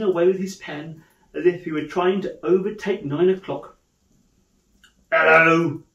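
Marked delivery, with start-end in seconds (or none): 5.1-5.8 loud, gruff mock-grumpy greeting; feigned growl. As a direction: growl it like a stern boss. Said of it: "growled Scrooge in his accustomed voice".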